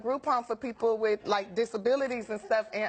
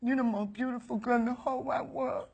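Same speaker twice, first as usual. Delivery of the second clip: earnest suitor; 2 semitones higher than usual. Retold or imitated. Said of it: "he's like".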